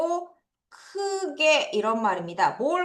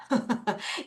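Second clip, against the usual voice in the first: brief, quiet laugh; small real laugh; soft chuckle; 8 dB quieter than usual. Real, not acted. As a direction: let out a short, soft chuckle. Teacher chuckles after checking understanding.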